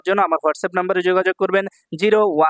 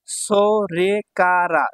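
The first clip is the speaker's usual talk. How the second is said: সোরেকারা very slow, every syllable held; much slower than usual. Shown as a demonstration. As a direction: stretch সোরেকারা into separate beats. Teacher sounds out new word.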